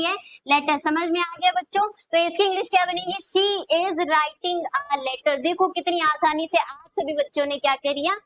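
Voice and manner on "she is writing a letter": very slow, every word stretched apart; slow dictation. Shown as a demonstration. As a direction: say it slowly so children can write it. Teacher dictating English sentence.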